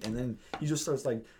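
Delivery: usual speaking voice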